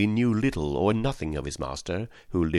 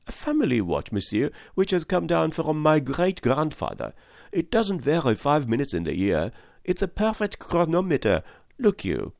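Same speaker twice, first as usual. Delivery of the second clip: higher, eager chattering servant voice; excitable French valet; 9 semitones higher than usual. A narrator's reading. Valet telling Fix of Paris, eager higher voice. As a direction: gush like a young valet abroad.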